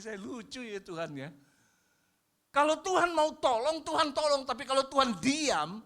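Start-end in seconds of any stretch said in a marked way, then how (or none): 2.5-5.8 high, loud preaching; fiery preaching. Acted. Pastor urges the congregation to own mistakes, fiery and high. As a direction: drive the point home.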